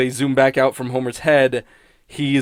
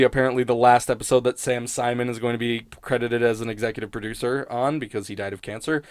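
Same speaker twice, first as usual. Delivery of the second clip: normal talking voice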